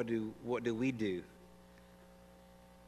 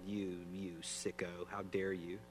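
slow, softer mock scorn; mock condemnation; 2 semitones lower, 7 dB quieter, slower than usual. Imagined judge scolding the woman, slow quiet mock scorn. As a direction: drag out the insult with quiet contempt. Imagined judgmental finger wagging.

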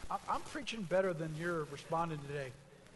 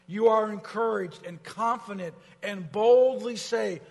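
louder, higher, pushing toward the crowd; rising preacher's call; 3 semitones higher, 9 dB louder than usual. Acted. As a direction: build it up to the congregation. Preaching at full force.

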